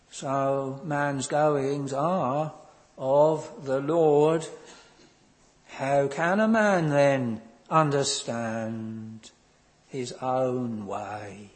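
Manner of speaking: slow, weighty reading of the text; solemn scripture reading. Acted. Preacher reading his text to the congregation, slow and solemn. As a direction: intone the verse with gravity.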